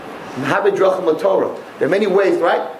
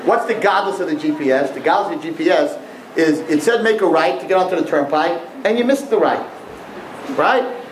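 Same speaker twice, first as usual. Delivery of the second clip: ordinary talk, nothing marked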